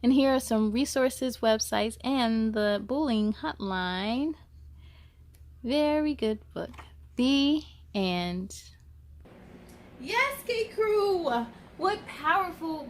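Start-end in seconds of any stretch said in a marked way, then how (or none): none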